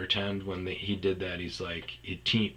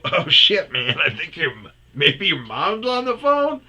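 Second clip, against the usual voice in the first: higher, louder, amazed; excited realization; 9 semitones higher, 9 dB louder than usual. Retold or imitated. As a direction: break the news with glee.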